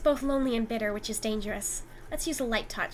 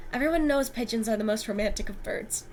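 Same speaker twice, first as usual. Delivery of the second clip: ordinary talk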